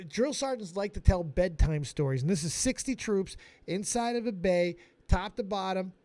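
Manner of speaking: ordinary talk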